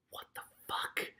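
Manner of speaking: whispered, stunned; baffled whisper. Real, not acted. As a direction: breathe it out in disbelief. Reader baffled by the plot.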